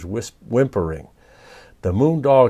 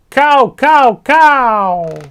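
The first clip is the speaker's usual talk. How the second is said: very high, loud squawking screech; parrot screech; 13 semitones higher, 9 dB louder, much slower than usual. Acted. Papagayo screeching from the branch, loud high parrot screech. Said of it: "And Papagayo shouted".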